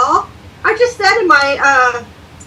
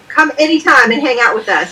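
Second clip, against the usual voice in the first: ordinary talk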